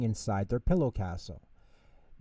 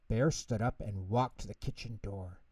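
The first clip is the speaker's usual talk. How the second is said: ordinary delivery, nothing marked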